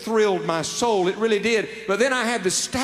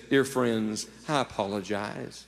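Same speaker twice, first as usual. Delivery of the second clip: soft, humble apology, dropping quiet; an understated, apologetic young preacher; 7 semitones lower, 4 dB quieter than usual. Retold or imitated. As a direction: say sorry gently to the crowd. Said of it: "with an apologetic tone".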